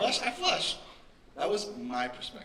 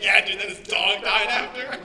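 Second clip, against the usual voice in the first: put-on loud, high eager teen blurting; awkward teen self; 7 semitones higher, 14 dB louder than usual. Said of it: "I'd be like".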